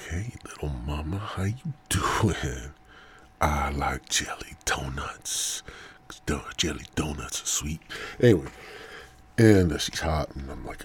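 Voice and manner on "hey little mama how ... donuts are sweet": flirty come-on. Acted. Acting out flirting with doctor.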